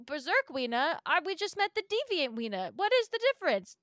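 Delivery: hammed-up baffled player; mock disbelief. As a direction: build from puzzled to exasperated. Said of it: "And you go".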